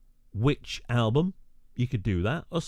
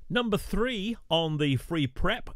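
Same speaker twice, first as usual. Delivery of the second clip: countdown announcer; 7 semitones higher than usual. Acted.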